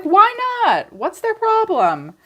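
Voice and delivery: high, loud, baffled "why not"; the reader, baffled by the cards, in high mock outrage. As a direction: squeal it out, disbelieving. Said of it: "I was like".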